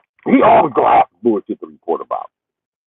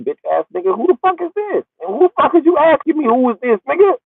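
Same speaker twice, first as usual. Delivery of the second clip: mock angry dude. Boyfriend on the phone snapping at the father. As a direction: bark back indignant at the caller.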